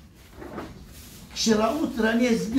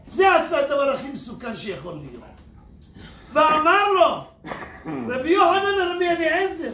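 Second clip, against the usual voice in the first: usual speaking voice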